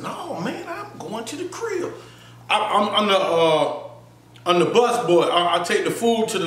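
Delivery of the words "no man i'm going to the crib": brushing him off under his breath; hushed reply. Retold or imitated. Telling Wood he's heading home, hushed and higher.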